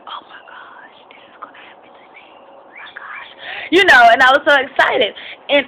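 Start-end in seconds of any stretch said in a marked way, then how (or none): 0.0-3.3 put-on breathless childlike whisper of wonder; awed whisper. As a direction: whisper it like a kid glued to the TV. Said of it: "I was sitting at the T V like".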